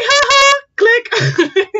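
high, loud mock cackle; hammed-up gleeful taunt; gleeful teacher imitation. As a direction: gloat playfully over the chaos. Gleeful teacher snapping photos, acted.

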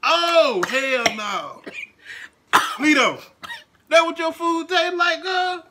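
loud high disgusted outburst; disgusted yell. Real, not acted. Disgusted after tasting dog food.

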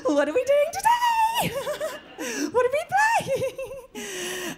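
very high, slow cheery voice; perky vibrator voice. Retold or imitated. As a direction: squeal it like an eager toy. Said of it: "she used to have this voice".

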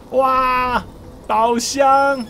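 thrilled high cry at a treasure chest; excited. Real, not acted. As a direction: burst out with delight. (兴奋惊呼)

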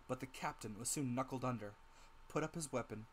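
ordinary delivery

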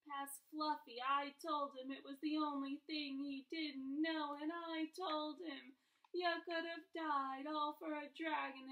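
loud, high wail of remorse; sobbing. A narrator's reading. Giant confessing his slip to Harry, sobbing. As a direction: pour out the guilt in an acted, drawn-out bawl. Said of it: "he sobbed, his face in his hands".

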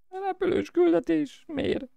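very high, slow squeaky whine; mock whine. Dreading flying mission. (nyafogó, magas hang)

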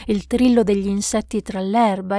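ordinary delivery

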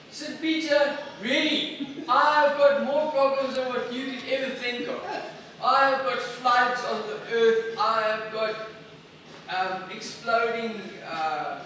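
God fed up with Peter; exasperated God. Retold or imitated. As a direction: list woes with exasperation.

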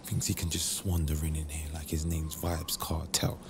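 low, hushed grumble to oneself; jealous muttering. Acted. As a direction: mutter your annoyance under your breath. Jealous man grumbles to himself.

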